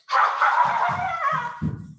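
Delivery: loud, high cackling laugh; a mischievous sprite laughs into the wood; fairy cackle. Acted. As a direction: cackle with glee over the spell. Spell cast on sleeper.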